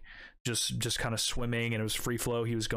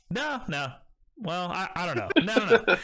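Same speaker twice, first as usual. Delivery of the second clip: higher, laughing no no no; teasing laughing denial; 7 semitones higher, 6 dB louder than usual. Retold or imitated. Dad jokes with his son about the snake, laughing denial. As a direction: tease while laughing through it.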